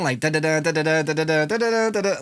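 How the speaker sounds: vocalized techno beat; mouth-made techno rhythm; beat mimicry. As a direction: scat the beat out, da-da-da. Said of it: "I'm talking".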